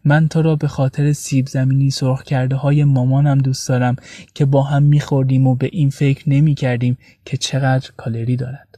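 usual speaking voice

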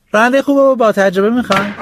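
put-on boy hawking himself as a driver; eager boy. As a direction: offer yourself at each door. Boy begs door to door.

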